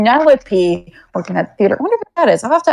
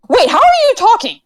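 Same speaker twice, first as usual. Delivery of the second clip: much higher, startled question; sudden surprise; 10 semitones higher than usual. Acted. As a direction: jolt up in disbelief. Kyra suddenly realizes no mouth.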